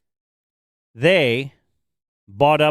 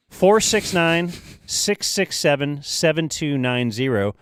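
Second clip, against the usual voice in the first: slow phone number read; slower than usual. Acted. Reading out sponsor phone number.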